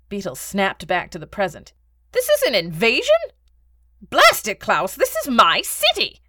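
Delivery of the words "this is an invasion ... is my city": higher, louder, indignant outburst; outraged protest. Acted. Beetle confronting the Baron over his city, outraged. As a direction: burst out in indignant disbelief.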